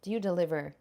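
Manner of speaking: normal talking voice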